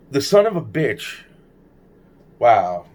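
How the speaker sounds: ordinary delivery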